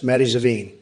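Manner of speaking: usual speaking voice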